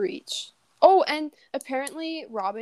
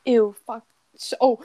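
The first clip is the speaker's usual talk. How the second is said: ordinary talk, nothing marked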